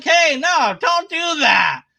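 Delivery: a high pleading shout that almost cracks. Pretend. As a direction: beg them to stop, half outraged and half pleading. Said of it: "And I'd be like".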